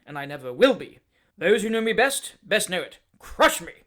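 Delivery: a hot-tempered man working himself up to a shout; fierce Dowler tirade. Acted.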